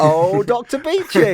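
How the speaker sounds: slow, drawn-out comic cry of the name; sitcom catchphrase. Retold or imitated. Host quoting a sitcom catchphrase to co-host, drawn out. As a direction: call it out like the old sitcom line.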